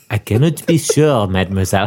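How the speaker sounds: mock French waiter, mademoiselle drawn down low; thick French accent. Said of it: "This is French".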